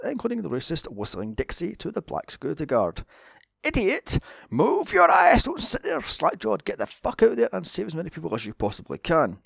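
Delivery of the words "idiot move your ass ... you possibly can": furious shouting; roaring angry yell; furious chef. Pretend. Yelling at slack-jawed Nick.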